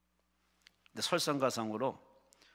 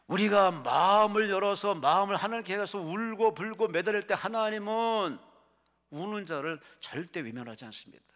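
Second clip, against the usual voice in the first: loud, high, driving exhortation; full-force preaching; 6 semitones higher, 9 dB louder than usual. Acted. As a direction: cry it out to the whole congregation. (목청껏 부르짖는 설교)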